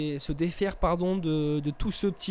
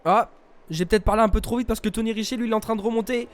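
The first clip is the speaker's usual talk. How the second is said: excited commentator; 5 semitones higher, 6 dB louder than usual. Real, not acted. Live sim racing commentary.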